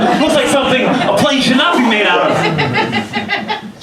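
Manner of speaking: high, drawn-out comic dismay; exasperated disbelief. Real, not acted. Nervous jumper complaining about the flimsy plane, exasperated.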